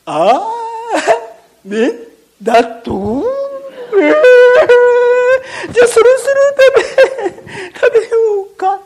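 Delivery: high, loud, gloating beast voice; gleeful crocodile. Acted. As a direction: relish the meal with a cackle. Crocodile wakes and prepares to eat. (獲物を前に喜ぶワニの声)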